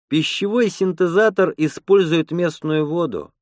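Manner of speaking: ordinary delivery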